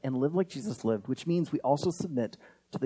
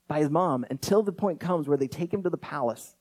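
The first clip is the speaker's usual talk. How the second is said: normal talking voice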